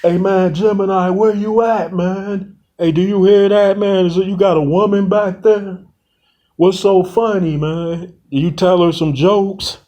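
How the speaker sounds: high, uneasy questions into the dark; nervous calling out. Acted. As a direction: call out, spooked by laughter behind the wall. Calling out after hearing laughter.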